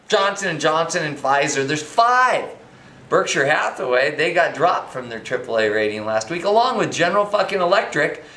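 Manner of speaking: ordinary talk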